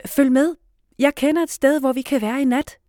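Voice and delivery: loud, high leading call. A narrator's reading. Liv guiding the pretend refugees, loud voice. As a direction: call out to lead the group. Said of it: "siger Liv med høj stemme".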